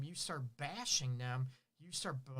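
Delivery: usual speaking voice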